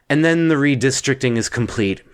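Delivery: usual speaking voice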